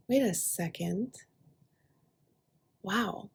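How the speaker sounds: softly voiced private thought; pause, then a hushed wow to yourself; quiet inner realization. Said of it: "it's very internal to you".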